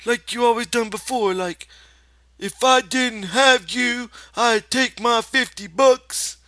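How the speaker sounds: put-on high, eager childlike voice; childlike Lenny. Lenny asks George to scold him, childlike.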